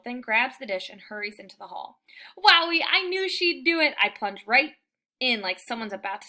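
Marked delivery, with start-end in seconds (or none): 2.4-3.9 high, loud, thrilled; gleeful triumph. A narrator's reading. Dog narrator as Hattie brings the dish, high and thrilled. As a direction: crow with delight. Dog gets his food.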